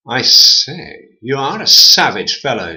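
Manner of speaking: a hint of a shocked gentleman; Swinging Door scolding the man, lightly haughty; lightly posh door. A narrator's reading. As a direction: tut like an offended gent.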